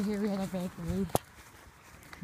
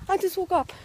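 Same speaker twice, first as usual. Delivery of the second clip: excited; 11 semitones higher, 7 dB louder than usual. Real, not acted. Sudden late notice exclamation.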